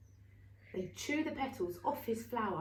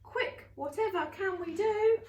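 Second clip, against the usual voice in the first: high, urgent, louder plea; panicked plea; 7 semitones higher, 5 dB louder than usual. Acted. Garden creature pleading with friends, panicked. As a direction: beg for a rescue plan.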